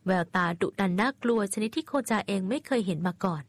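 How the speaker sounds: ordinary delivery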